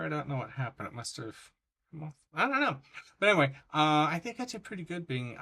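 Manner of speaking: usual speaking voice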